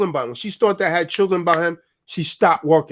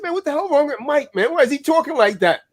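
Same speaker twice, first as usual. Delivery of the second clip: mock puzzled cousin; 8 semitones higher than usual. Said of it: "my cousin said".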